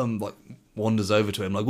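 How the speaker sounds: ordinary delivery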